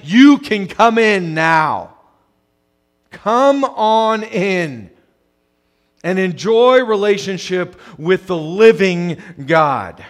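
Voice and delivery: drawn out, very slow invitation; slow, welcoming call of heaven; slow inviting call. Acted. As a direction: stretch each word wide open.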